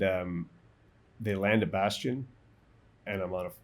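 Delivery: usual speaking voice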